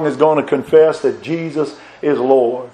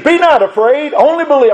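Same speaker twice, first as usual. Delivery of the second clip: higher, fervent refrain; preached refrain; 9 semitones higher than usual. Acted. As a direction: ring the verse out like a battle cry. Repeated verse, preached high.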